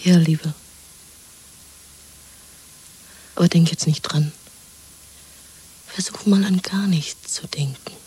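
hushed, breathy secret whisper. Acted. Woman soothing her lover in hiding: soft whisper. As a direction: whisper so no one can overhear.

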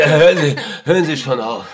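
panicked plea against the scalpel; frightened pleading. Acted. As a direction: beg him to stop, scared. Prisoner threatened with scalpel.

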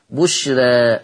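بشرى ending in a vowel tilted toward e, not a full a; taqlil. Shown as a demonstration. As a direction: say بشرى the Warsh way, final ألف half-leaning to e. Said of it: "مش بشرى". (بشرى بالتقليل لورش)

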